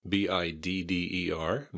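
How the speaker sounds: usual speaking voice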